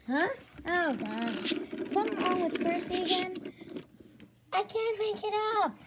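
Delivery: normal talking voice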